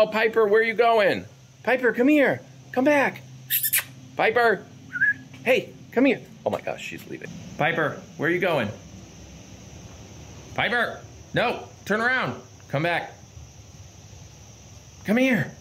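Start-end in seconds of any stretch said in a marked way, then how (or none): 0.0-6.2 calling the dog. Real, not acted. Calling the running dog back. 7.6-15.5 high call after the dog. Real, not acted. Calling the running dog back.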